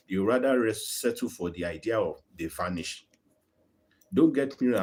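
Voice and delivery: usual speaking voice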